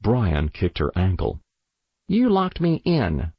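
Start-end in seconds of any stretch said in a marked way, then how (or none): 2.1-3.3 put-on high-pitched child's complaint; accusing little brother. A narrator's reading. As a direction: pipe up like a wronged small boy. Small brother accuses his sister.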